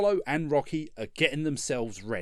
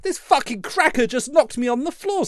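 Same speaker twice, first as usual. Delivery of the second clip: shocked Apollo; 7 semitones higher, 5 dB louder than usual. Retold or imitated. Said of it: "Apollo's like".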